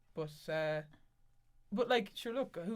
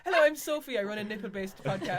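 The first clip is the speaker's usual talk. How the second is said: higher, louder mock podcast-host greeting; mock host intro; 4 semitones higher, 9 dB louder than usual. Mock intro to friends for a nipple podcast, higher and louder. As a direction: announce it like a cheesy show host.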